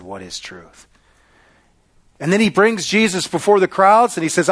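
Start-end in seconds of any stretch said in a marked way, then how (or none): 0.0-0.9 low, soft, falling question; hushed quoted question. Retold or imitated. Preacher recalling Pilate's words to Jesus, hushed and low. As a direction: let the question sink away quietly.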